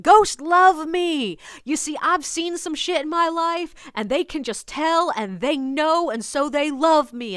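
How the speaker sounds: high, loud, boastful man; interrupting stranger. Retold or imitated. Stranger cutting in on the tour guide, boastful. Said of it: "he interrupts me to be like".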